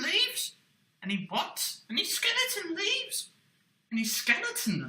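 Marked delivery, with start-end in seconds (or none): 1.9-3.3 high, eager little puppet voice. Acted. Puppet quizzing the host about leaves, high puppet voice. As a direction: ask it like a wide-eyed puppet.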